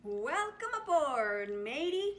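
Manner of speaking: loud, jolly sailor greeting; captain greeting his rescued friend; hearty shout. Acted. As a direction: boom it out with cheer. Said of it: "Captain Dalbert shouted".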